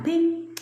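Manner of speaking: high, short "ping"; imitated text alert. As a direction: chime it like a phone notification.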